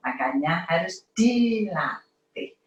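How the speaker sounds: ordinary delivery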